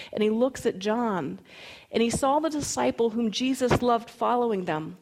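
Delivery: normal talking voice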